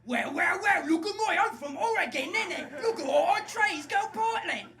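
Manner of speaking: loud, high, hammed-up Oregon accent; over-the-top shouted Oregonian caricature; mock Oregon accent. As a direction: bellow a silly Oregon accent. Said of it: "I can do any accent".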